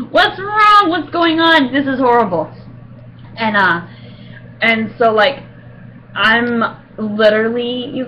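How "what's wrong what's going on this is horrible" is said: high, loud, panicked plea to God; frantic prayer. Retold or imitated. Praying in panic in the dentist's chair. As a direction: cry out to God in alarm. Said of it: "I prayed".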